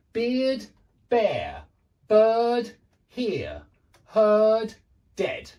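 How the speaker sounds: each word apart, "bird" and "herd" high and loud; careful word-list demo; vowel list. Shown as a demonstration. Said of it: "completely different vowel sounds".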